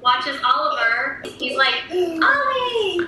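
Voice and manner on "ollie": high-pitched mimicked child; playful high kid voice; imitating excited son. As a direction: call out like a delighted little boy. Mother voices her excited young son.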